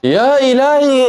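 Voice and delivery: high, slow stunned exclamation. Real, not acted. A fan stunned by a late goal, drawn-out disbelief. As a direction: gasp it out in disbelief.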